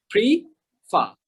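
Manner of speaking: "প্রি, ফা" slowly, a clear gap between the two; teacher dividing "প্রি, ফা" in two; split into syllables. Shown as a demonstration. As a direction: sound out "প্রি" and "ফা" as separate beats. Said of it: "এখানে দুইটা syllable".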